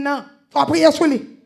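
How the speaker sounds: loud preaching cry on "faut prier sur lui"; shouted exhortation. Acted. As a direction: hammer the call to pray. Preacher repeats exhortation louder.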